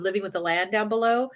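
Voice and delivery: ordinary talk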